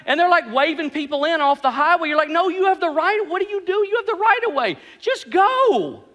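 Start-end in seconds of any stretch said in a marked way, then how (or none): 2.3-6.0 high-pitched mock exasperation; comic road frustration, pitched way up; exasperated driver. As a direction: plead with a dithering driver, voice climbing high. Exasperated driver at hesitant motorist.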